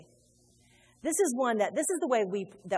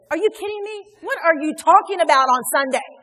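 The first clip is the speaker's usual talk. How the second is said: much louder, higher incredulous rebuke; rebuking Holy Spirit; 6 semitones higher, 12 dB louder than usual. Retold or imitated. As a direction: call the pastor out, shocked. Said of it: "the Holy Spirit said".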